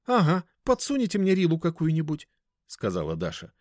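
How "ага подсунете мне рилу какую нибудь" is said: put-on high-pitched girl's voice, suspicious; distrustful girl customer. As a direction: push back warily in a high voice.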